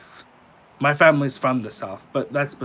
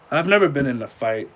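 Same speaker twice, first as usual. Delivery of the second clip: usual speaking voice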